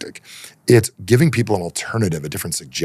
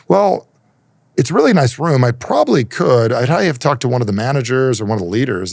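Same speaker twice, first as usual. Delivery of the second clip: mock stalling clerk, pitched up and louder; lightly acted reluctant front-desk clerk; imitated hesitant clerk; 6 semitones higher, 5 dB louder than usual. Acting a hesitant hotel clerk.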